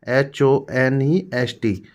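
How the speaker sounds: letters एच ओ एन ई एस टी spelt one by one, slowly; spelling out slowly. Shown as a demonstration. Teacher to students, spelling the word slowly. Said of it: "इसको हॉनेस्ट नहीं".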